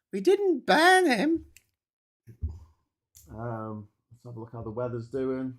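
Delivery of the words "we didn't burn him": very high, loud and slow put-on voice; high, loud, slow comic voice; silly high voice. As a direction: squeak it out slowly like a cartoon.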